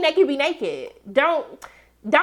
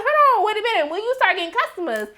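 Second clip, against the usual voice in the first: high indignant mock voice; 9 semitones higher, faster than usual. Recapper voicing Lil Murda's outrage, high and indignant. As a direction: pitch it way up, outraged and snappy. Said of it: "Lil Murda was like".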